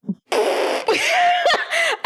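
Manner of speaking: high-pitched burst of real laughter; incredulous laugh. Real, not acted. Reacting to her sister's dramatic phrase, incredulous laughter.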